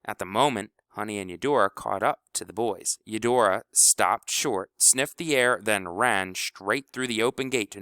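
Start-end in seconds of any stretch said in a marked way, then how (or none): none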